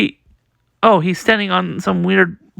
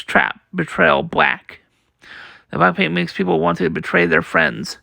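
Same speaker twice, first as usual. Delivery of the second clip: plain reading voice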